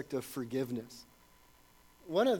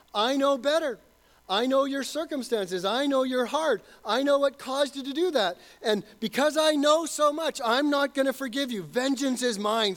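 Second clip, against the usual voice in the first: arrogant mock voice; 6 semitones higher, 4 dB louder than usual. Voicing the unforgiving person.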